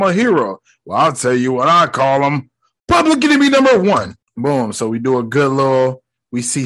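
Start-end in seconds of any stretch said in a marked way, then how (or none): 2.9-4.1 high, quick, punchy proclamation; hammed-up, raised-pitch condemnation; mock denunciation. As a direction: announce it like an outraged TV pundit. Imitating Jameson denouncing Spider-Man.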